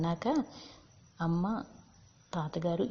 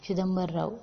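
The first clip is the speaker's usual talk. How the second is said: usual speaking voice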